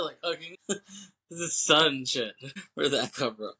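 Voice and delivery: ordinary talk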